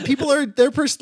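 normal talking voice

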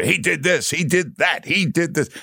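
mock authoritative announcer. Host mocking Bloomberg's ad announcer, hammed-up announcer voice. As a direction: boom it out like an ad voice-over. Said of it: "An authoritative announcer tells you".

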